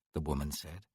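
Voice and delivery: plain reading voice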